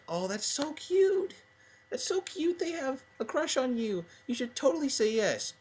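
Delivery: high-pitched girly squeal, mock gushing; gushing girls.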